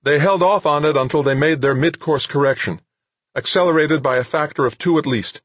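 high, loud, urgent technician's voice; tense technician. Acted. Comtech briefing Wixon on the missile, urgent and high. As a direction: report the numbers with urgency.

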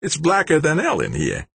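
high, tense character voice; character's voice. A narrator's reading. Complaint in the dark stairway, high character voice. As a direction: pitch it up, complaining about the dark.

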